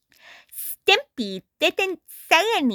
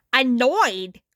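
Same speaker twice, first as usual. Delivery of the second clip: ordinary delivery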